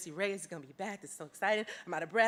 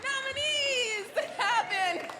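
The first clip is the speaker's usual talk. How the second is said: laughing; much slower than usual. Real, not acted. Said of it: "I'm so excited".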